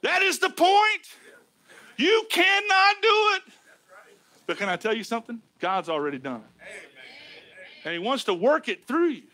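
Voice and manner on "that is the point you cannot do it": high, drawn-out pulpit exclamation; emphatic preaching. Real, not acted. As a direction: hammer the point home, word by word. Preaches at full force.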